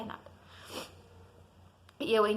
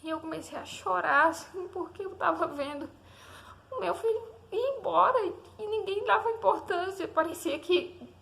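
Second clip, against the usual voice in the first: real tearful strain, voice climbing high; fighting tears while reliving it, higher-pitched; choked up; 6 semitones higher than usual. Real, not acted. Mother reliving her baby nearly dying. (voz embargada, quase chorando)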